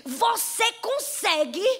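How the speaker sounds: hand-on-hip bossy big sister, pushing hard; mock-stern child ordering her sister on; bossy older sister. Older sister orders little Laura to dress the doll, bossy and insistent. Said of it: "botou a mão na cintura e fez".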